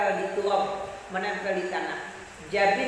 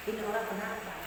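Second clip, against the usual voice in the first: mild muttered quote; 9 dB quieter than usual. Retold or imitated. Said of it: "Nabi hanya mengatakan".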